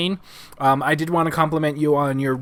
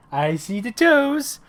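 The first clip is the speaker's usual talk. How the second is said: put-on high, slow, sing-song baby talk; 8 semitones higher, much slower than usual. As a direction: coo it slowly up high to a toddler. Talking to child about toes.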